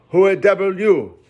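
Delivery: high, loud barbarous cry; startled cannibal demanding who's there; gruff cannibal. A narrator's reading. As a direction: bark it out in broken English. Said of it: "he at last said".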